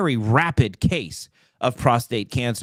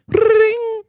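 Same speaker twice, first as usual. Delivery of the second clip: phone ring; 7 dB louder than usual. Acted. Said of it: "One eight hundred Taylor Ferber".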